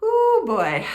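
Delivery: loud, high rueful ooh; rueful exclamation. Real, not acted. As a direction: groan it out with a wry laugh. Exclaiming about covid and vaccines.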